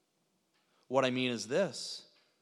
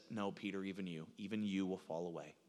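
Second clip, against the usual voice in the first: low, hushed, sober reply; quiet warning; 9 semitones lower, 11 dB quieter than usual. Retold or imitated. Jesus answering an overconfident Peter, quiet warning. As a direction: say it softly, pitched well down.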